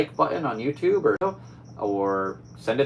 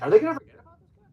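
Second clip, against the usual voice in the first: higher, louder, fast excited quote; excited worry; 14 semitones higher, 8 dB louder, much faster than usual. Retold or imitated. Reviewer recalling his watching, higher and louder. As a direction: blurt out the worry quickly. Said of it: "where I was like".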